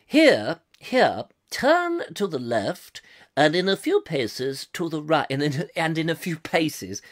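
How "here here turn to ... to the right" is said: put-on slow, measured old BBC announcer; plummy BBC accent, every word weighed. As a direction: read it in posh, deliberate BBC English. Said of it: "another bit of the B B C".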